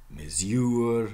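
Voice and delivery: MESURE as "mez-YOOR"; Middle English pronunciation. Shown as a demonstration. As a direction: say mesure with a y-glide, end stressed. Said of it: "in Middle English mesure".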